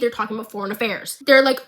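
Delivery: normal talking voice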